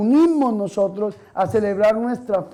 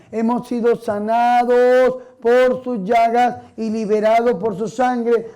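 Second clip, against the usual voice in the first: ordinary talk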